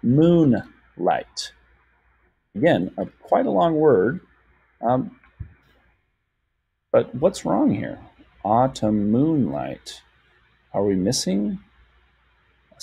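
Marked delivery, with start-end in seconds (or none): 0.0-1.5 MOONLIGHT split as moon... light; syllable by syllable. Shown as a demonstration. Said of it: "break up words by syllable". 8.4-10.0 slow, measured word parts; drawn-out counting read; syllable by syllable. Shown as a demonstration. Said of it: "break up words by syllable".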